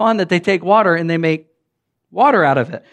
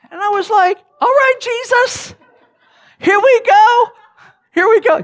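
much higher, squeaky excited self-talk; nervous excitement; 4 dB louder than usual. Retold or imitated. Preacher seizing a chance to preach, giddy high voice.

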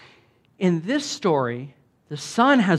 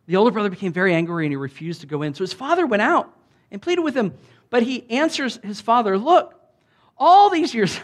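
preaching Scripture reading; 6 semitones higher, 4 dB louder than usual. Acted. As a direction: proclaim the verses with full force.

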